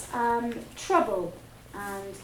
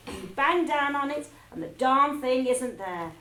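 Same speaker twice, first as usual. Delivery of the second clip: loud, scornful rant; ranting headmistress; 3 semitones higher, 7 dB louder than usual. Acted. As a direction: bang the words down with contempt. Trunchbull ranting about girls.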